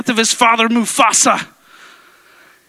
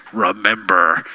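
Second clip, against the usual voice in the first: a hint of the ghost voice; 4 dB quieter, slower than usual. Echoing the ghost voice.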